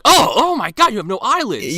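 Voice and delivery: high, loud exclamation; hammed-up startled outburst; mock shock. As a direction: blurt it out as if horrified. Kel's shocked outburst, retold.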